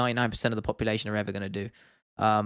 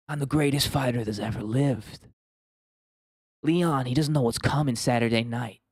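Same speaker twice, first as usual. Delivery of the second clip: hushed campfire-story Usman boast; lightly voiced Usman, slow and murmured; Usman impression. Streamer repeats his Usman impression for chat, murmured boast. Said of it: "Talking like he's telling stories around the campfire".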